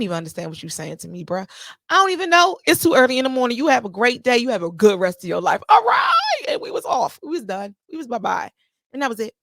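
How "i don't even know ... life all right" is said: loud and higher, dismissing him; loud sarcastic send-off. Real, not acted. Telling off the man who insulted women, loud sarcastic goodbye. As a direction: wish him a good life and slam the door.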